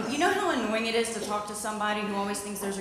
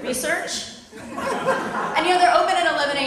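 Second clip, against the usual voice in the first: mock innocent; 8 semitones higher, 3 dB louder than usual. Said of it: "And I'm like".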